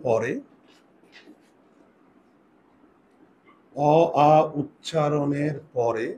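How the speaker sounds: slow, spaced dictation for writing down; slow dictation pace. Shown as a demonstration. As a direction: dictate each part slowly so they can write. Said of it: "dictating a spelling rule slowly".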